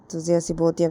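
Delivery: ordinary delivery, nothing marked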